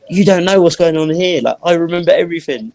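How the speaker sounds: insisting to people who can't hear; frustrated inner plea. Retold or imitated. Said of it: "So I'm thinking".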